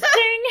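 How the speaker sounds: high, loud imitated bell ding. Host imitating the bell in the ending, high ding. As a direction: ring it out like a bell. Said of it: "bell from And You and I goes ding".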